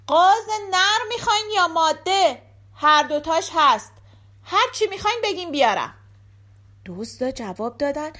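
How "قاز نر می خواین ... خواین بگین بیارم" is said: loud, high calling out; shouting. A narrator's reading. Giske calls from the henhouse to the thieves, shouting. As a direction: yell a question across to accomplices. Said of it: "داد زد".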